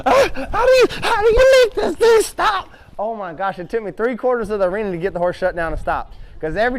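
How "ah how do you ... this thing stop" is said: high, loud panicked cry; panicked yell. Retold or imitated. Rider on a runaway horse, shouting in panic. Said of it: "And I".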